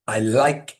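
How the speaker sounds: LIKE stressed with a rising glide. Shown as a demonstration. Teacher correcting the flat version, "like" lifted high. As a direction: punch "like" upward from a low "I". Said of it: "normally it's a higher pitch".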